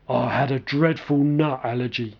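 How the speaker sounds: low, unhurried big man voice; slow and low, a lightly voiced gentle giant; deep slow voice. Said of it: "he began in a deep, slow voice".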